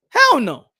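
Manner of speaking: high, loud mock refusal; indignant Australian fans. Voicing Australian fans refusing the next fight, loud mock indignation. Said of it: "The Australian people gonna be like".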